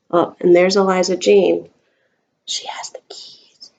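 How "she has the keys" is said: hushed whisper. Real, not acted. Mother whispering to Grace about Eliza, hushed. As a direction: let her in on a secret. Secret aside to child.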